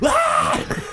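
sharp high cry of pain; pained yelp. Retold or imitated. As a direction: yelp as the bark tears skin. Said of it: "I was like".

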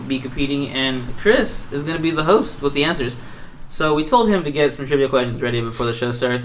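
normal talking voice

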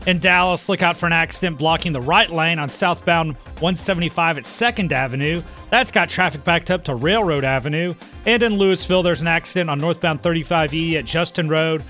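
brisk traffic update read; radio traffic report. Acted.

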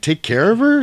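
high, sharp mock-incredulous question; imitating Jules. Jules doubts the man's errand, asks in mock disbelief. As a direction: echo it back like you can't believe it. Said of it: "He's like".

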